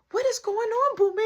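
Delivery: high-pitched scolding question; nana calling out. Retold or imitated. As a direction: imitate a grandmother demanding answers. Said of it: "you hear her".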